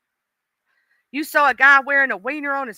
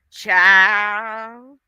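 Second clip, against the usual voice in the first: put-on long drawn-out, louder "child"; Miss Paulette; 7 dB louder than usual. Streamer reacting to mole gossip, imitated voice, drawn-out exclamation. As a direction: stretch the word out loud and long. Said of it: "in my Miss Paulette voice".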